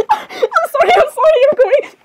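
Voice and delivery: high, laughing squeal; overcome, voice high with laughter; giddy outburst. Acted. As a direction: apologise through helpless giggles. Overcome after he speaks.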